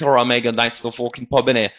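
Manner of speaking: mock English lad accent, lightly done; a touch of a laddish English accent; English regional accent. Shown as a demonstration. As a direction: show off an English bloke accent. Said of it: "je suis plutôt bon en imitation".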